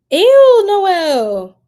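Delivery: high, loud disgusted "ew". Real, not acted. Streamer reads a gross comment in chat, loud disgusted ew. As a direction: recoil at the comment.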